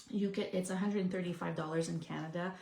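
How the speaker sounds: ordinary delivery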